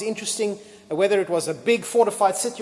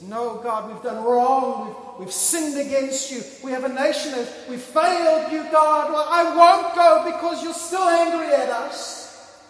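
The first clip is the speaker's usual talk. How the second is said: Joshua's anguished refusal; 6 semitones higher than usual. Retold or imitated. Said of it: "Joshua could have said".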